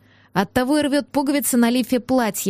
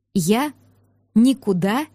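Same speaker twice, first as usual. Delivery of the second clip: slow, word-by-word refusal; deliberate pauses, stubborn defiance; defiant, through teeth; slower than usual. A narrator's reading. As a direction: force it out through gritted teeth. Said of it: "процедила ему в лицо".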